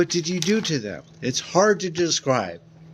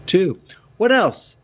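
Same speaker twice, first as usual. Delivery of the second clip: surprised; 7 semitones higher, 5 dB louder than usual. Acted. Gerald's sharp question.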